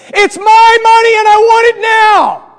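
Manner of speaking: high, drawn-out holler; long, loud mimicked commercial yell; commercial shout. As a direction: yell it out the car window like the TV ad. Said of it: "stick their head out a window".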